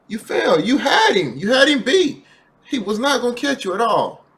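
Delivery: rising loud disbelief; exasperated. Real, not acted. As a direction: let the frustration climb. Frustrated at suspect stopping.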